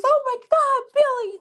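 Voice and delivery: high-pitched ditzy lady in a joke; shrill lady. Acted. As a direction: play the panicked lady. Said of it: "a lady says".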